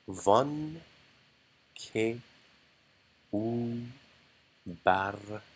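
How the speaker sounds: slow, each syllable spaced apart; one syllable at a time, clearly. Shown as a demonstration. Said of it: "syllable by syllable".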